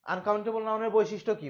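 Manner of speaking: normal talking voice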